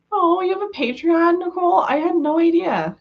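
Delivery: high, bright with real surprise; genuinely delighted to hear the news; excited surprise. Real, not acted. Finds out viewer has Patreon.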